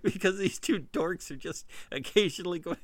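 usual speaking voice

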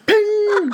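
high, sharp "ping" noise; ping sound effect. Pretend. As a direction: squeak out a little ping. Mimics idea bouncing off.

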